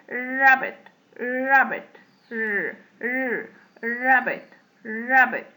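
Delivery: drawn-out R starting "rabbit"; tongue-held R. Shown as a demonstration. Teacher demonstrating R for learners, long-held R in "rabbit". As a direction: hang on the R before finishing "rabbit". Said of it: "لساننا متعلق فيه".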